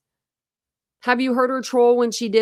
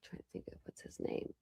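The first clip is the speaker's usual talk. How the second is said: barely audible, voiceless whisper; whispering to oneself; 22 dB quieter than usual. Real, not acted. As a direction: whisper it under your breath while trying to remember. Searching memory aloud to herself.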